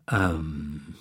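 stall, lost for words; searching for words. A narrator's reading. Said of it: "his brain searched for the right words".

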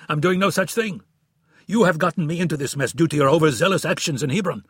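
loud, high angry retort; angry snapping. A narrator's reading. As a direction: keep snapping back at him. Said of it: "snapped Goldberg".

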